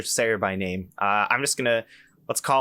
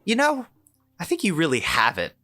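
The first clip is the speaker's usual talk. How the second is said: a sudden high sweet-talking recruiter voice; imitating recruiter; 6 semitones higher than usual. Imitating the recruiter as she coaxes him, high and sugary. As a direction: coo it like a recruiter flattering him. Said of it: "she looks at me and she says".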